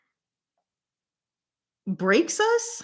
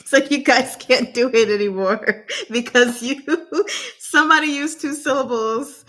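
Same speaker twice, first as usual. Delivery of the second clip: laughing; 4 semitones higher, 3 dB louder than usual. Real, not acted. Laughing while scolding the players.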